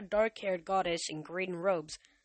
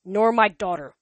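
grumbling; 2 semitones higher, 10 dB louder than usual. A narrator's reading. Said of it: "Zeus grumbled".

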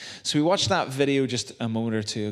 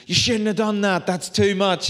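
high, louder put-on protest; mock protest; 8 semitones higher, 4 dB louder than usual. Family protests the paid dinner bill, high fussy mock protest. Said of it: "And everyone goes".

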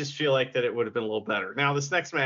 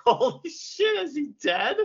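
high, alarmed disbelief; shocked. Retold or imitated. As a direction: gasp it out in shock. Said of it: "I was like".

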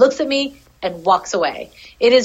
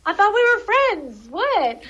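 much higher, betrayed squeak; a small creature feeling betrayed; hurt little rat; 10 semitones higher, slower than usual. Pretend. Voicing the rejected rat.

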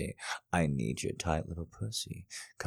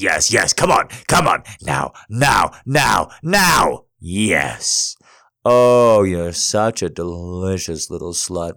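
shouting; 13 dB louder than usual. Acted. Shouting at climax.

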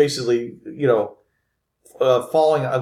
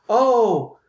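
high female "oh" of sympathy; pitying lady; 10 semitones higher than usual. Acted. Lady looks at Philip, high pitying voice. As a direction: lift it high, a lady's pity. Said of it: "looks at you, Philip, and she says".

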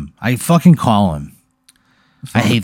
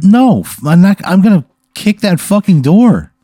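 insistent player; 7 semitones higher, 3 dB louder than usual. Acted. Player insisting on kicking door.

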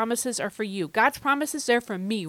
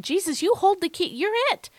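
much higher, louder and fast; excited Peter; 12 semitones higher, 5 dB louder, faster than usual. Retold or imitated. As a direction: cry it out in amazed devotion. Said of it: "what Peter said".

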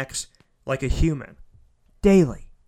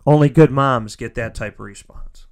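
louder, full-voiced statement; emphatic praise; 6 dB louder than usual. Real, not acted. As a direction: declare it firmly, out loud. Repeated line, full voice then hushed.

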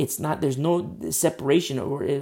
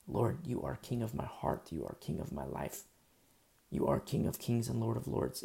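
quiet prayer; 2 semitones lower, 7 dB quieter than usual. Acted. Praying to Christ in quoted prayer.

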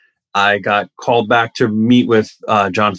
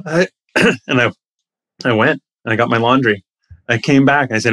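usual speaking voice

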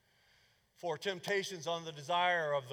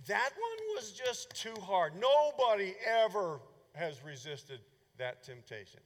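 mocking excuse-maker; 5 semitones higher, 5 dB louder than usual. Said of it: "you've heard this rationalization through the years".

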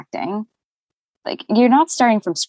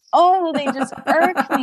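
laughing; 8 semitones higher than usual. Real, not acted. Laughing about annoying mouth sounds.

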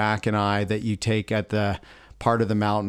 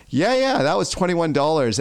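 put-on kids laughing it out; 6 semitones higher than usual. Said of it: "And they're like".

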